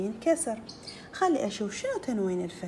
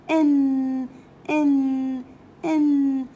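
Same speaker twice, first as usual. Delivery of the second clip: "إن" called out louder; loud teaching drill; 3 semitones higher, 8 dB louder, much slower than usual. Shown as a demonstration. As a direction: announce the sound loudly to the class. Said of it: "شنو صوت تنوين الكسر؟". (نطق "إن" بصوت عالٍ)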